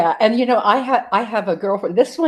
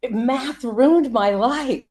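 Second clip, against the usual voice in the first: slow, higher-pitched mock confession; dramatic testimonial; 5 semitones higher, 4 dB louder, much slower than usual. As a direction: draw it out like an anguished ad testimonial. Quotes woman in commercial's testimony.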